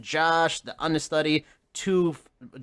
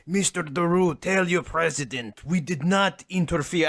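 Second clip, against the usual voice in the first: mock Russian accent, lightly put on; hammed-up Russian official voice; 4 semitones higher than usual. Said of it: "Is that your Russian accent?".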